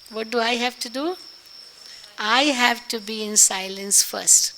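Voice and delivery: ordinary talk, nothing marked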